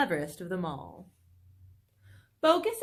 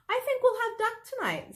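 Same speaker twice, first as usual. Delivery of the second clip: much higher, lightly voiced wife; high voice of a fox's wife; Missus Fox; 13 semitones higher than usual. A narrator's reading. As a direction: lift the voice up high for the vixen. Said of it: "said Missus Fox".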